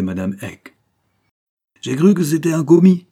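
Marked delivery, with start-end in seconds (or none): 1.8-3.1 loud, high flustered cry; startled exclamation of apology; exclaiming. Acted. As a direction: blurt it out in surprise. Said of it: "s'écria le père Eck".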